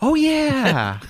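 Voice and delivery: high, drawn out "oh"; realizing he's caught, sheepish; sheepish realization. Retold or imitated. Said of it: "I'm like".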